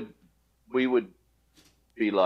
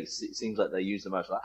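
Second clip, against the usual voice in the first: usual speaking voice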